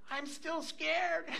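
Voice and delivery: put-on high, slow, soft whimper of a kid; scared grandchild. Grandchild refusing sleep at grandpa's, drawn-out small high voice. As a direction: imitate a child drawing out the fear.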